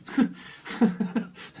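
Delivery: a short breathy laugh; laughing at herself. Real, not acted. Laughing as she admits that's her too. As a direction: laugh it off, amused.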